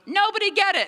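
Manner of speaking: high, loud order to the family; mom's command. Retold or imitated. Mom telling the family at dinner not to answer, high loud order. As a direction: bark it over the ringing phone. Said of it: "My mom goes".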